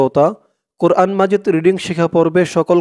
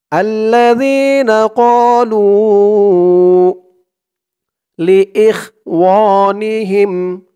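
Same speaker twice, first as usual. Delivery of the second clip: slow, higher chanted recitation; Quran recitation; 4 semitones higher, 4 dB louder, much slower than usual. Shown as a demonstration. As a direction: draw out every vowel like tajweed. Recites the verse.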